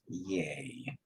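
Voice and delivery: flat deadpan whispered yay; mock cheer, barely voiced; sarcastic yay. Real, not acted. Painter mocking his own dread with a sarcastic yay.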